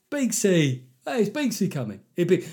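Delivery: high, excited call to a mate; eager mate. Acted. Stag-do lad asking if his mate is coming, excited. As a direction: pipe up high, keen to know who's coming.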